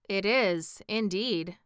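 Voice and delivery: plain reading voice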